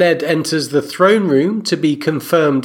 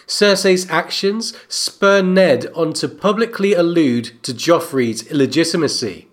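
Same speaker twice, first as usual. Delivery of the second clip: normal talking voice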